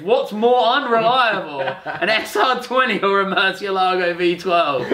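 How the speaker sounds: amused, drawn-out laughing question. Real, not acted. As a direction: giggle out the silly pitch. Laughing pitch of a video idea.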